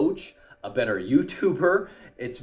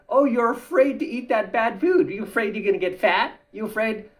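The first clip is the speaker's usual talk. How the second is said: high, louder whiny needling voice; mocking taunt; 11 semitones higher, 6 dB louder than usual. Mocking critics who call refusing junk food fear, high and whiny. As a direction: needle someone like a schoolyard taunter. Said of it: "It's like saying".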